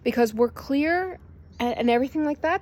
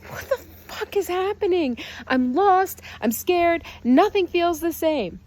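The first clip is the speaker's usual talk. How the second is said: panicked human; 8 semitones higher than usual. Acted. The frightened human self crying out, panicked and tearful. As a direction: wail it like someone lost and terrified. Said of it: "we have the human going".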